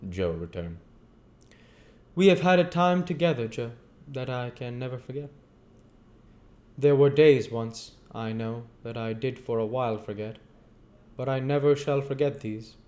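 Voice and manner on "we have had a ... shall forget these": higher, earnest youth; heartfelt young man remembering; young Pip voice. Acted. Pip speaks to Joe.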